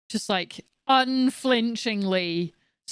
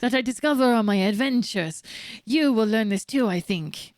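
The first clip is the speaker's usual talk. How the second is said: bad Italian accent. Old adventurer passing on wisdom, badly faked Italian accent. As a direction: wobbly fake Italian accent. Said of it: "this is not Italian".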